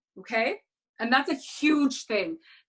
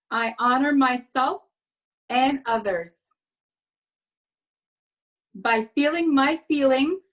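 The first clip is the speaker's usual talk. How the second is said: spaced-out affirmations with long pauses, for the audience to repeat; slow affirmation recital. Shown as a demonstration. Host leads ladies in affirmations, slow and deliberate. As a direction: state each line slowly, letting it land. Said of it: "Repeat after me".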